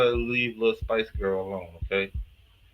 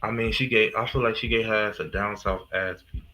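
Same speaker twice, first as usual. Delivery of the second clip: ordinary talk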